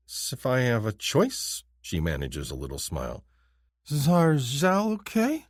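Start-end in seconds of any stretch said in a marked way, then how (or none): 0.1-1.6 slow, halting higher voice; halting alien woman. Acted. Tia's halting words. 3.9-5.5 higher, very slow questioning; slow alien question. Acted. Tia asks.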